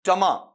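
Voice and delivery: "टमा" as tuh-MAA; corrected first syllables. Shown as a demonstration. Teacher asking students what it becomes, corrected "tuh-maa". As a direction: say "टमा" with a weak uh, then long aa. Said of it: "बल्कि क्या बनेगा बताओ आपका?".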